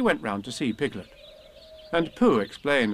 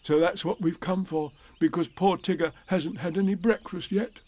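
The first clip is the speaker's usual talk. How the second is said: lightly voiced bear, higher than narration; Pooh; 5 semitones higher than usual. A narrator's reading. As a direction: explain kindly as a storybook bear, pitched up. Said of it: "said Pooh".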